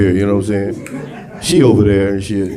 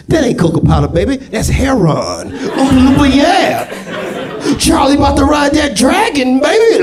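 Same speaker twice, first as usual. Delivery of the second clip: jive Oompa Loompas; 14 semitones higher than usual. Acted. Oompa Loompas telling Charlie, high hyped jive voice. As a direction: hype it up like a street Oompa Loompa. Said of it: "Here come the Oompa Loompas".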